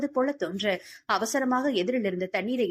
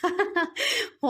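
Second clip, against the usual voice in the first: high, bright-hearted chuckle; amused laugh; 10 semitones higher than usual. A narrator's reading. Friend hears the lover's eager question, amused laugh.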